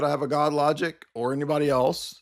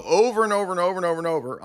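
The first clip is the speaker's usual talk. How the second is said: rapid repetition; 5 semitones higher, much faster than usual. Real, not acted.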